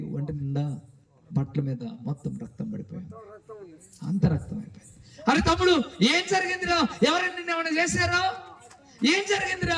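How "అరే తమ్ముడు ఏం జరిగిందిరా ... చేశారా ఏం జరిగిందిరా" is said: alarmed brother. Acted. Alarmed older brother at door.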